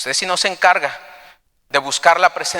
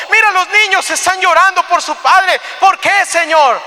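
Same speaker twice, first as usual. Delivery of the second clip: high, pleading cry to God, building in force; anguished prayer; 12 semitones higher, 6 dB louder than usual. Acted. Joshua praying to God after the defeat, anguished pleading. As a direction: plead louder and higher with each question. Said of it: "Josué empieza a orar al Señor".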